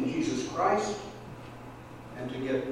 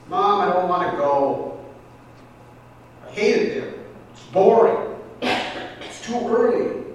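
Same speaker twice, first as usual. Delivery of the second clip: put-on higher, whiny reluctant kid; whining boy; 4 semitones higher, 4 dB louder than usual. Boy under the covers begging his mother, whiny and higher.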